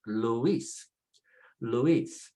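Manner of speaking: "Luis" as lu-EES; "Luis" with the weight on IS; second-vowel stress. Shown as a demonstration. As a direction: glide through the u, land on the i in "Luis". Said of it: "put the stress on the second vowel".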